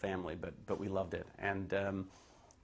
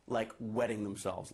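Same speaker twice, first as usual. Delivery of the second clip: ordinary delivery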